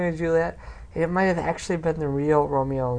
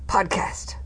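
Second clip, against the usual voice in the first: whisper; 3 semitones higher than usual. Real, not acted.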